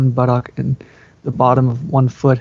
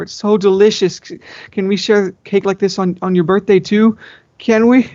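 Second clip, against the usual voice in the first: bleated; 7 semitones higher than usual. Acted. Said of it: "I bleated".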